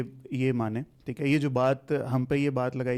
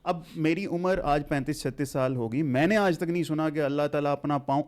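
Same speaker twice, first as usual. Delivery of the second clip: ordinary talk, nothing marked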